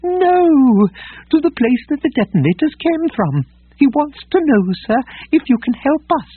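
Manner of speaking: high, prim, agitated droid voice; fussy protocol droid. Acted. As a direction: fret in a high prissy robot voice.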